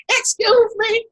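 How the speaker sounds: squeaky, high excuse me to a stranger; voice goes up. Retold or imitated. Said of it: "your voice goes up about three or four octaves".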